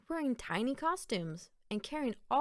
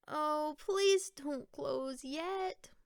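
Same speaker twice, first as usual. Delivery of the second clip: high, slow anxious plea; pleading; 7 semitones higher, much slower than usual. Acted. Littlest pumpkin pleading as the stand closes, high and slow. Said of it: "thought the littlest pumpkin".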